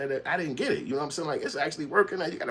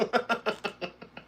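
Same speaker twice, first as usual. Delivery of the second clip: laugh; 12 semitones higher, 5 dB quieter than usual. Real, not acted. Laughing at a viewer's comment.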